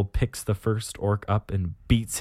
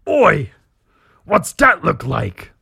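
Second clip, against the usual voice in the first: loud, slow, higher orc question; 5 semitones higher, 7 dB louder, slower than usual. Acted. Orc boy asks the boss from behind, loud orc voice. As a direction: call out as a curious orc underling. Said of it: "One of his boys asks from behind him".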